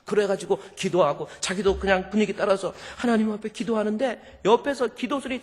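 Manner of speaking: ordinary delivery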